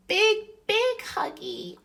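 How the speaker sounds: put-on high, slow babyish whimper; a touch of toddler wail; mock weeping baby. Baby begging Mum for a hug, high slow whimper. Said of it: "wept the baby".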